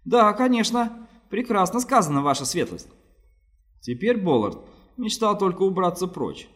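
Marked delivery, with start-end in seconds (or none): 0.0-2.9 much higher, fawning and nervous; nervous flattery. A narrator's reading. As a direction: flatter him anxiously. Fat man flatters in fear.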